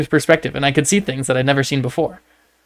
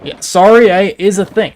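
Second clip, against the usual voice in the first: mock Canadian; 6 semitones higher, 6 dB louder than usual. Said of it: "I had a friend whose family said".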